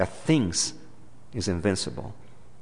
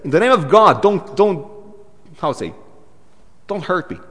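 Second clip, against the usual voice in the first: higher, loud frantic plea; begging demon; 6 semitones higher, 5 dB louder than usual. Retold or imitated. Preacher acting the demon begging Jesus not to hurt him. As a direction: beg like a cornered demon. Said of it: "The demon says".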